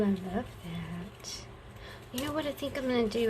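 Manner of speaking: ordinary talk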